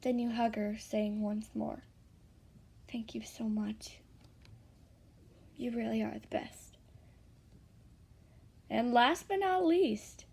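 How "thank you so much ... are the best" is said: soft, near-whispered thanks; quiet, tender thanks; hushed gratitude. A narrator's reading. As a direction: murmur it gratefully during a hug. Grateful hug in story.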